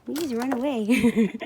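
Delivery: slow, loud pleading. Real, not acted. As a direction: plead loudly and slowly. Talking to animals, pleading loudly.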